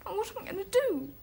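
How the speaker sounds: high-pitched, distressed wail; fretful, helpless cry; anxious despair. Acted. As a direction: lament as disaster closes in. Despairs over discovered portrait.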